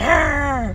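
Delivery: a short, high mock growl of frustration; frustrated growl. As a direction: act out snapping at a mess. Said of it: "Like, just like".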